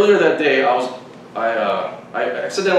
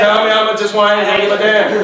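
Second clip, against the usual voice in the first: high, loud retold demand; hammed-up impatient holler; mock outburst; 8 semitones higher, 6 dB louder than usual. Acted outburst to friend about wine.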